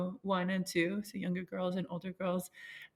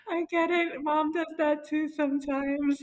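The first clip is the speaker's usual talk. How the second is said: higher, louder, slow and sweet; reassuring mom; 9 semitones higher, 6 dB louder, slower than usual. Retold or imitated. Mom comforting her crying daughter, high and sweet. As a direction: reassure her like a loving mother. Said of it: "And I thought".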